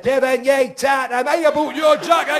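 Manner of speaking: headmaster bellowing in thick Geordie; posh headmaster turned broad Geordie; headmaster now Geordie. Retold or imitated. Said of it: "The headmaster said".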